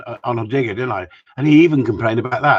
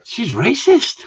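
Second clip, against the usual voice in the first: slow, high-pitched mock accusation; hammed-up shocked squeal; mock outrage; 11 semitones higher, 3 dB louder, much slower than usual. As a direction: draw it out high, as if scandalised. Mock accusation of woman in background.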